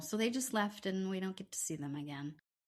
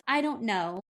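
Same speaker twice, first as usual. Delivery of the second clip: louder retold shrug of a reply; raised-voice imitation of her; mimicked non-answer; 3 semitones higher, 5 dB louder than usual. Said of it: "like that".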